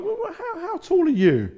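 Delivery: high, laughing, sizing-up question; mocking rival. Preacher mocking a competitive acquaintance, high and smug. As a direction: mimic a smug competitor who is sure he is taller. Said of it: "is like".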